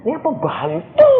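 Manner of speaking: high-pitched mock whine; acted-out reluctant complaint; whining protest. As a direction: protest to God in a squeaky-high plea. Retold protest to God about maid.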